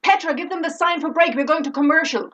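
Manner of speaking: annoyed director. Retold or imitated. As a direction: repeat the cue with mild impatience. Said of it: "looks kinda annoyed".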